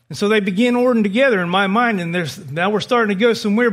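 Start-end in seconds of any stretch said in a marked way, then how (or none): none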